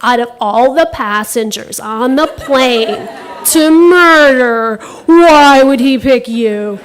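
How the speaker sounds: loud, slow mock scolding of herself; the voice in her head shouting her down; mocking inner voice. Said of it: "this voice in my head".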